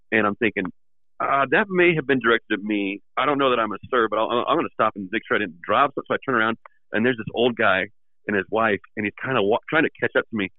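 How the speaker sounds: ordinary talk, nothing marked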